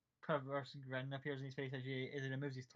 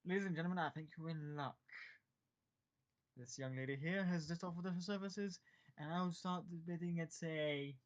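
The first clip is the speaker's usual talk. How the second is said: showy auctioneer host; 4 semitones higher than usual. Acted. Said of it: "there's like".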